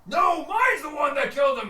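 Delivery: high, loud contradiction; squabbling orc yelling back; angry orc retort. Acted. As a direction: shout the rival orc down. Second orc shouts back.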